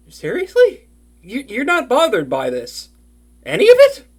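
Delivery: high, loud disbelief; can't believe they're fine with it; incredulous. Acted. Disbelief friend not bothered.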